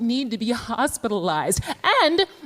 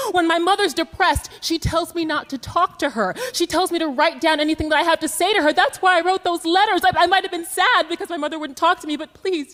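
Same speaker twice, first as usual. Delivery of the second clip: desperate pleading; 8 semitones higher than usual. Retold or imitated. Pleading with police taking her away.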